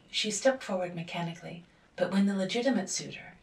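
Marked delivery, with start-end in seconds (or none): none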